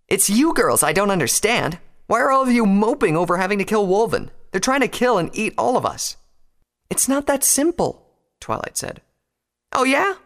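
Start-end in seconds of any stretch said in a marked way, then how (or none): 0.1-6.1 raised-pitch, heated argument; indignant. A narrator's reading. Rainbow argues with her friends. 6.9-8.1 high, slow objection; pleading protest. A narrator's reading. Twilight protests in the quarrel. 9.7-10.1 high, loud challenge; sharp retort. A narrator's reading. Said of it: "Rainbow retorted".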